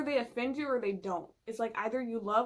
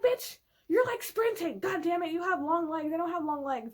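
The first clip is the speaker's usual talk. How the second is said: much higher and louder, mock outraged; exasperated complaint; 6 semitones higher, 6 dB louder than usual. To Caesar who walks too fast, indignant high complaint. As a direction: whine back at him, breathless and indignant. Her own retold reply, complaining.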